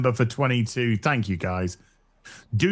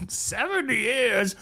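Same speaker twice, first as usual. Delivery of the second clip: slow, drawn-out; 8 semitones higher, 4 dB quieter, much slower than usual. Pretend. A dazed streamer joking he's been trapped for years, slow and drawn out. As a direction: stretch it out slowly, dazed. Joke about being trapped.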